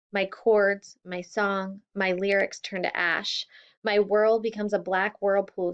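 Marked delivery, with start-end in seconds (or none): none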